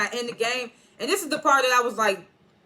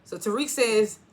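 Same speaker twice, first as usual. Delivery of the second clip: ordinary delivery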